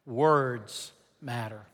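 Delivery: slow, drawn-out emphasis. Shown as a demonstration. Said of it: "Say it one more time".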